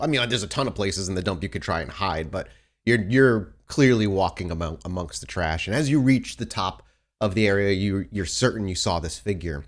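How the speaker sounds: ordinary talk, nothing marked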